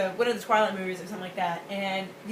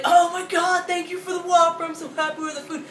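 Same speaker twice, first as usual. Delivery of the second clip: grateful fan; 8 semitones higher, 7 dB louder than usual. Retold or imitated. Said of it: "I was like".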